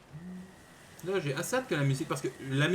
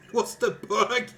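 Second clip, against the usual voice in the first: loud high startled cry; shocked outburst; 8 semitones higher, 6 dB louder than usual. Real, not acted. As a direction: blurt it in disbelief. Sudden surprise in game. (surpris et fort)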